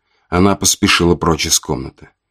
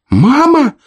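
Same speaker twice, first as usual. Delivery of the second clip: put-on very high, loud child's cry; his brother calling out too; second child calling; 5 dB louder than usual. A narrator's reading. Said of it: "присоединился голос брата".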